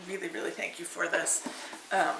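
normal talking voice

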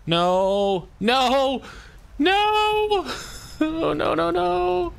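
panicked high-pitched yelling; shrieking no in real panic; being chased. Real, not acted. Creature being chased in game.

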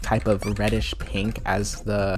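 ordinary talk, nothing marked

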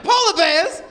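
high, loud eager pleading; eager kid. Retold or imitated. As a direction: beg to be told. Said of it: "I'm like".

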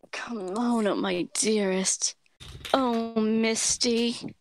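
very slow character voice, near her own; Desert Blossom. Shown as a demonstration. Actor demonstrates her character's trigger line, slow and drawn out. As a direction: linger on each word, drawing it out. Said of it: "Desert Blossom is a bit closer to my".

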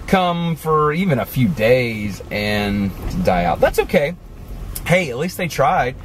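usual speaking voice

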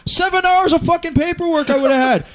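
high, loud furious cop rant; exaggerated flipping-out officer impression; swearing, belligerent cop. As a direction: rant and curse at full tilt, up high. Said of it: "he's swearing at me".

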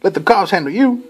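very high mock yell at the attacker; high-pitched hammed-up girlfriend telling him off; angry friend. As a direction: squeal it like a fed-up girl storming off. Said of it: "She's like".

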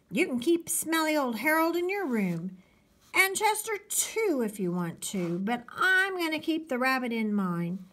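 put-on high-pitched kid bargaining hard; arguing little boy. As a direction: squabble with your brother, pitched high. Toby arguing with his brother.

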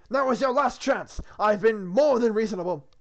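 high, very loud kingly warning; indignant ultimatum. Acted. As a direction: lay down a last warning to the castle. Arthur's ultimatum to the castle.

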